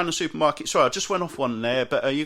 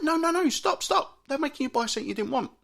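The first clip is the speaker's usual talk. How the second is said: high, frantic inner voice pleading no; mock panic; 10 semitones higher than usual. Comic telling himself off in the aisle, high panicked squeal.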